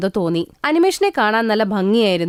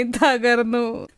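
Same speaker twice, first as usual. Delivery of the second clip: broken fading echo; much slower than usual. Acted. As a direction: let തകർന്നു fade out slowly, drained. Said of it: "ഏങ്ങി ഏങ്ങി കരഞ്ഞോണ്ടേയിരുന്നു".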